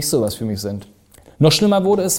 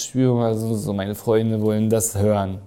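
put-on heavily drunk; 5 semitones lower, 4 dB quieter, slower than usual. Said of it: "im harten Bierrausch und sagst".

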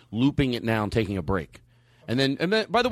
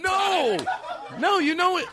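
high-pitched, indignant outburst; indignant protest; slower than usual. Real, not acted. Host objecting to being teased about pants, indignant and high.

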